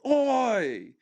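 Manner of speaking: high, slow hammed-up Aussie accent; mock Australian. Mocking the imagined Aussie man, high Australian accent. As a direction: drag out "Aye" in a silly Australian twang.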